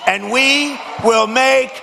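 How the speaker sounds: high, ringing rally chant building to the last line; rally finale. Real, not acted. Speaker closes his Georgia rally with a chanted refrain, shouting high. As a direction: hammer each make America line at the crowd. Rally speech closing peroration.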